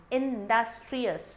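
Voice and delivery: "industrious" drawn out in separate syllables. Shown as a demonstration. Teacher counts syllables for students, "industrious" split up slowly. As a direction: sound out "industrious" slowly, part by part. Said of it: "four syllable".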